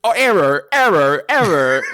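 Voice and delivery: loud, high robotic error alarm; glitching robot. Retold or imitated. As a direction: blurt error like a broken AI. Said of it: "The A I's in his ear going".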